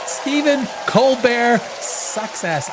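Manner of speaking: drawn-out taunt, each word stretched; slow mocking jab. Real, not acted. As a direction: drag it out to mock him. Mocking Colbert to viewers.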